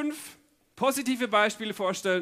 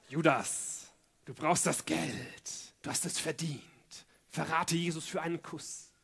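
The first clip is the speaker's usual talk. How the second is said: sly tempter's voice; 4 semitones lower, 8 dB quieter than usual. Acted. Inner voice tempting Judas to betray Jesus, low and hushed. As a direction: coax softly and low, like a tempter in the head.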